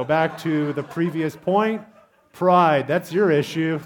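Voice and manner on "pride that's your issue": loud call-out. Real, not acted. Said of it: "calling out the proud".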